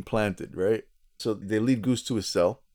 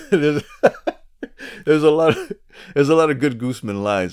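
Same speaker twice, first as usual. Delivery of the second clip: loud, amused, laughing through the words; high, loud voice breaking into laughter; 6 semitones higher, 7 dB louder than usual. Real, not acted. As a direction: crack up while talking. Cracking up at co-host's imitation.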